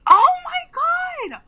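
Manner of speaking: real delighted shock; shocked shriek. Real, not acted. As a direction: shriek as it suddenly clicks. Recognizes the actress suddenly.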